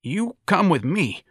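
high, slow, faltering order; waning self-confidence. A narrator's reading. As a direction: order him with fading confidence. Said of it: "said to Ignatius with waning self-confidence".

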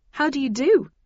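flustered, high and loud greeting; taken aback, pitch leaping up; spluttered. A narrator's reading. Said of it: "I spluttered".

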